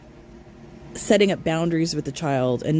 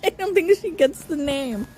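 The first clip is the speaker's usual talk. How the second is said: high, laughing remark; amused tease; laughing aside; 10 semitones higher than usual. Real, not acted. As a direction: talk through giggles. Laughing at cohost.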